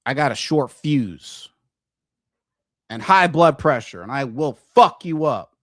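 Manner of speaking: angry threat. Real, not acted. Threatening a critic.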